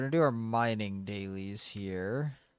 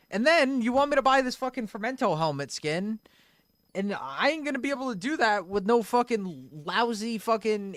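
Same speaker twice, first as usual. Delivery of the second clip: high, loud exasperated rant; ranting loudly; 9 semitones higher, 4 dB louder than usual. Real, not acted. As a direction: vent about the prices. Ranting about money in game.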